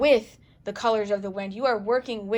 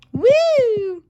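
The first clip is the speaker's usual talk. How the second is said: startled woo; 12 semitones higher, 10 dB louder than usual. Real, not acted. Castle figurine wobbles, reacts.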